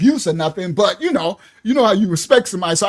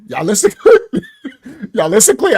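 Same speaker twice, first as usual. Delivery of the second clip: laughing; 5 dB louder than usual. Real, not acted. Laughing while teasing story.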